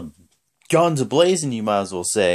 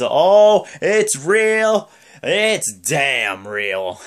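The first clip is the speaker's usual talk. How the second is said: high, loud start dropping to a drawn-out finish; hammed-up catchphrase, stretched at the end; Kurt Angle; 4 semitones higher, 5 dB louder, much slower than usual. Wrestler's catchphrase on his return, mock emphatic. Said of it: "the only thing Kurt Angle says is".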